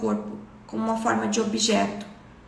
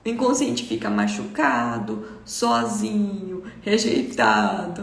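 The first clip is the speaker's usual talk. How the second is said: slow, drawn-out, low and sorrowful; lonely, dragged-out lament; wounded; 4 semitones lower, slower than usual. Retold or imitated. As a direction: stretch each word in mild mock hurt. Voicing the hurt unconscious, a child.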